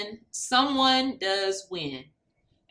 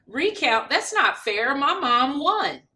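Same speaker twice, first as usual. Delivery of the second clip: indignant protest; 5 semitones higher, 5 dB louder than usual. Acted. Child upset about the recount, indignant outburst. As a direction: protest that it isn't fair.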